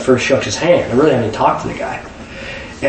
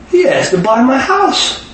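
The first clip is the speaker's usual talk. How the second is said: amazed telling; 10 semitones higher, slower than usual. Retold or imitated. Dentist telling buddy the story.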